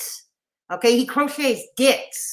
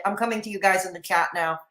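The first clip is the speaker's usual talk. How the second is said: usual speaking voice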